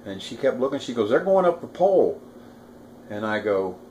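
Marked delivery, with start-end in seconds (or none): 1.1-2.2 higher-pitched imitation of a frightened coworker; retold alarm. Coworker warns him of men outside, mildly alarmed. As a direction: retell her alarm with pitch raised. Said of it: "she was really scared".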